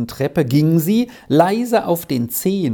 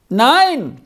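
high, raised-voice reply; calling back; 9 semitones higher, 4 dB louder than usual. A narrator's reading. Arthur answers Squirrel upstairs, calling back. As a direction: call the answer back up the stairs. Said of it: "rief Arthur zurück".

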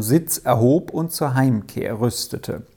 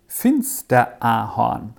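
plain reading voice

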